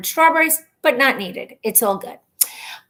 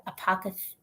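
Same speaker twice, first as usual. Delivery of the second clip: normal talking voice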